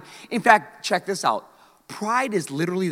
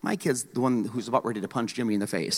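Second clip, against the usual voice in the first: dry deadpan comeback; 8 semitones lower, 9 dB quieter than usual. Retold or imitated. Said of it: "And I'm like".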